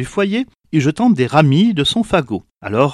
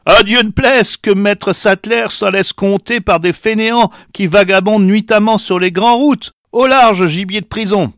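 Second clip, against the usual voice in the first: loud, higher, scornful shout; shouting farmer; 8 semitones higher, 5 dB louder than usual. A narrator's reading. Rich farmer shouts at the beggar, scornful and loud. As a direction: drive the beggar off with a harsh cry. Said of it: "criant à celle qui l'implorait".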